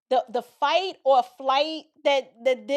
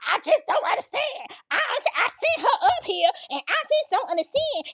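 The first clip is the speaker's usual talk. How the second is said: whiny mama; 9 semitones higher than usual. Retold or imitated. Mother pleading with the school in a high falsetto. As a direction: squeak it out like a helpless mama. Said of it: "And the mama".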